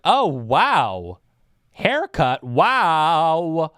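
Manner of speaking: drawn-out, loud, high-swooping wow; imitating someone loudly taken aback; mock amazement. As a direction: overdo the shocked reaction to a haircut. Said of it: "like, with that emotion".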